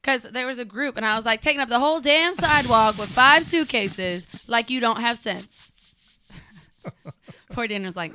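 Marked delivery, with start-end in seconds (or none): 1.4-5.7 mock scolding. Scolding tourists on sidewalk.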